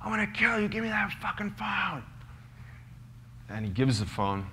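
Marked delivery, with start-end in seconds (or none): 0.0-2.0 much higher, barked threat from a bulldog cop; policeman yelling. Retold or imitated. A policeman yelling at a prisoner with a phone. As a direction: scare the man into handing over his phone. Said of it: "he's like".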